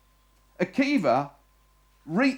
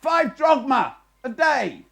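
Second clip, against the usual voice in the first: guard shouting down; 8 semitones higher, 6 dB louder than usual. Acted. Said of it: "The young man shouts down".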